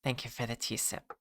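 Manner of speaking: ASMR voice. Pretend. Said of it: "A S M R voice".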